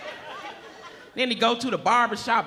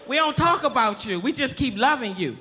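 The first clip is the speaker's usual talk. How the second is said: ordinary talk, nothing marked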